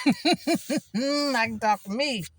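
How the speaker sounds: loud, slow, laughing line. Real, not acted. Telling viewers she'll just talk to herself, laughing. Laughing at her own joke.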